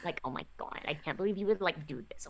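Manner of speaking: soft whispered repeat; whispering. Acted. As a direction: whisper it under your breath.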